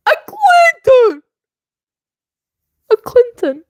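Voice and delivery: shrieking the name in outrage; outraged disbelief. Real, not acted. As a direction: say it like you can't believe it.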